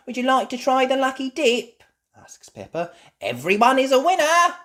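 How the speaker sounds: high, loud eager little pig; Peppa Pig. Acted. Peppa inviting Mister Bull to the lucky dip, high.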